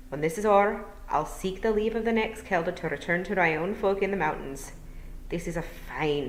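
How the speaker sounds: gentle Scottish burr, rolled r's; Scottish old Feagle. Acted. William explaining to Tiffany, courteous Scottish burr. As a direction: speak as a courteous Scottish elder.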